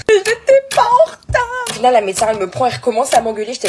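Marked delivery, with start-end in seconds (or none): 0.1-1.4 high, slow squeaky protest; mock outraged whine; indignant squeal. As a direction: squeak it out, much higher and louder. Protesting to receptionist.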